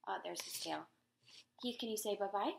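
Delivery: ordinary delivery, nothing marked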